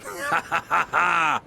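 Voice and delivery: airy "ha-ha-ha" laugh; light laugh. Acted. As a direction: laugh it off in a light, descending run. Laughs before admitting not understanding.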